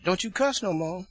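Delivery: high, loud mock scolding; singsong auntie. Religious aunt warning nephew, high mock singsong. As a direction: imitate a singing-talking aunt laying down the law. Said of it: "they all talk like they singing".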